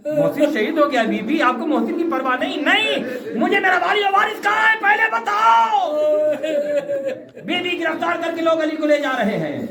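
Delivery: high, urgent preaching cry; impassioned lament. Acted. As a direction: build to a desperate, high-pitched demand. Acts out the anguished exchange. (ऊँचे सुर में बेचैन मातमी फ़रियाद)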